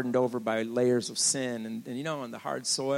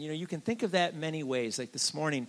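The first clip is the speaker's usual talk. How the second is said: ordinary talk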